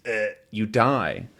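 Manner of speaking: plain reading voice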